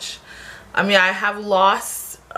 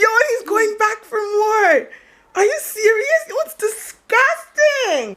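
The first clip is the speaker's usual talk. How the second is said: disgusted disbelief. Real, not acted. Friend drinks more juice.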